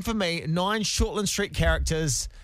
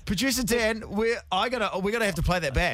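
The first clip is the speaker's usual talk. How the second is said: flustered panic; 6 semitones higher than usual. Real, not acted. Host panicking over car.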